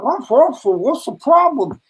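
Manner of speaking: high, pleading mock-alarmed voice; mock panic. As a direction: act out bewildered pleading with a policeman. Retold panic before armed policeman.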